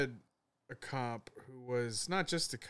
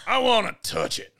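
higher, louder, leering voice; loud creepy old man; 10 semitones higher, 12 dB louder than usual. Retold or imitated. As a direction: demand it like a brazen drunk. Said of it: "And he's like".

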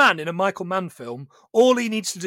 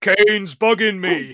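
mock film quote; 5 dB louder, slower than usual. Quoting a film line in voice.

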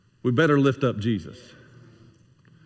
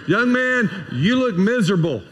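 blunt lay preacher; 5 semitones higher than usual. Retold or imitated. Layman singles out a miserable teenager from the pulpit, emphatic call. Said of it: "oh, how I could say that".

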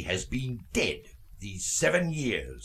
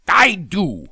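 louder and higher, a short angry retort; curt snap; 7 semitones higher, 10 dB louder than usual. Acted. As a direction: bite it off sharply. Scrooge snaps back at nephew.

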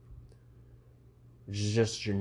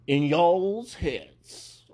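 high, loud and very slow y'all's, mock Southern; exaggerated Southern drawl; 8 semitones higher, 5 dB louder, much slower than usual. Shown as a demonstration. Correcting his own slang in a hammed-up Southern drawl. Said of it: "I don't like y'alls".

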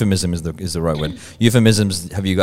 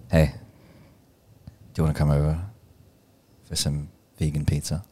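low seductive murmur; 7 semitones lower, 11 dB quieter, faster than usual. Shown as a demonstration. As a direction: drop low and quiet to seduce. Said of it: "you say it. Say it".

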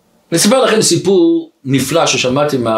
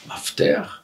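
whispered, questioning echo; puzzled whisper; 10 dB quieter, much slower than usual. Retold or imitated. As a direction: repeat it under your breath, baffled. She echoes his word, puzzled. (לחישה תוהה)

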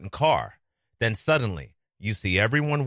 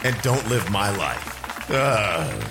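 dramatic sigh; much slower than usual. Acted. Closing comic lament.

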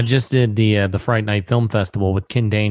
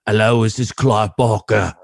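put-on British accent; 3 dB louder than usual. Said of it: "I hear a voice come on the line".

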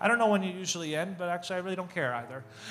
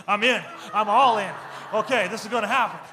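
psyched-up resolve; 6 dB louder than usual. Retold or imitated. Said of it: "and I'm like".

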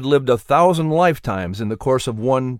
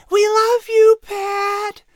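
put-on much higher, louder little girls calling; whisper shout; 4 dB louder, much slower than usual. A narrator's reading. Little sisters calling from the doorway, whisper-shouting. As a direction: call out eagerly but hushed. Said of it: "they whisper shouted".

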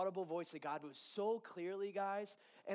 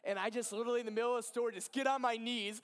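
normal talking voice

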